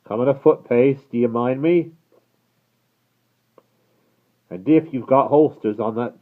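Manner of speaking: guard threatening the approaching rider; threatening call. Acted. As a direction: warn him off hard.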